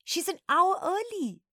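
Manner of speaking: high, loud dismay; urgent high-pitched outburst; alarmed. A narrator's reading. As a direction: cry out in panicked disbelief. Child alarmed at teacher's early return.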